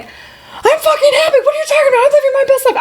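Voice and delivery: high, strained mock outrage, half laughing; mock indignant protest. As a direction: squeal a defensive denial. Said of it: "I was so mad by that".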